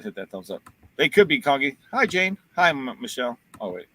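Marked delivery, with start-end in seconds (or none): 0.0-0.6 Bonnie's quiet request. Retold or imitated. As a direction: Hit that thumbs up much softer and lower. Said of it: "even Bonnie said".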